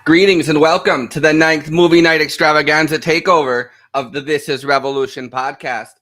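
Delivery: loud, booming podcast welcome; show announcer. Acted. Host welcoming viewers to movie night, loud announcer. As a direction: open the show big and loud.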